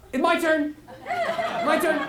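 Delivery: a listener itching to answer; fast, high-pitched mock eagerness; eager. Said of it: "excited listener thinking".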